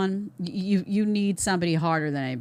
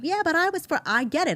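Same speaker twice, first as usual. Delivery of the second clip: high mocking whine of a critic; mocking objector; 9 semitones higher than usual. Commentator mocking viewers who push back, in a high mock voice. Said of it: "everybody always throws me".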